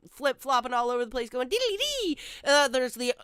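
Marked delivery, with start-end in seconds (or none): 1.5-2.2 sing-song jokey Irish diddly-dee, pitched higher; lightly mock a jig-happy Irishman; mock Irish lilt. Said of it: "the Irish are over here just jumping around".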